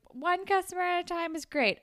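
high-pitched, eager quote of a character; chirpy mock eagerness. Voicing a show character.

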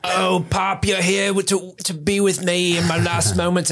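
high-pitched pitiful creature; dying orc to papa. Acted. Dying orc greeting his papa, pitiful. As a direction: plead feebly in a high voice. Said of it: "he's like".

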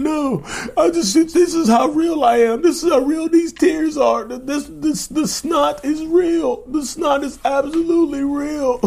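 very high, wailing mock sob; hammed-up fake crying. Narcissist wailing at partner about real tears, very high mock sob.

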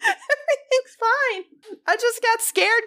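very high, drawn-out squeal through laughter; laughing squeal. Real, not acted. Friend apologizing for a false scare, squealing with laughter. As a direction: helplessly laugh through the words.

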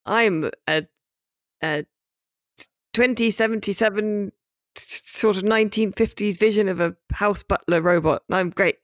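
lightly voiced polite butler robot; a hint of a cheerful robot butler. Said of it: "And he's like".

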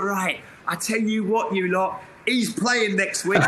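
slow, half-laughing bark of a manager; hammed-up boss laying down the law, slow; mock manager's order. Retelling his manager Graham's order to the squad, slow and mock stern. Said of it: "And he's just gone".

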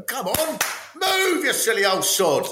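high-pitched impatient mock yelling; hectoring. Relative goading old fundraiser to keep walking, hectoring mock yell. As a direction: chivvy them along in an exasperated high voice. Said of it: "you could have someone going".

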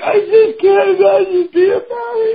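high, tearful mock sob voice; a high weepy niece, choking up; imitating crying niece. Host imitates his crying niece for the co-host, high and tearful. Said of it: "She's like".

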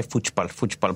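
Brazilian Portuguese. Retold or imitated. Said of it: "that's why I just say".

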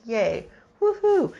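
high, drawn-out half-hearted cheer; mock cheer. Cheering that Judith lives.

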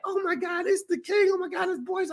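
high, breathless starstruck gush; gushing fan. Retold or imitated. Fan gushing over meeting him, high and thrilled. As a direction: squeal like a superfan. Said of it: "so she's like".

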